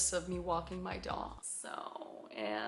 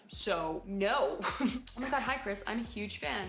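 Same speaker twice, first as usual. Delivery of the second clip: ordinary talk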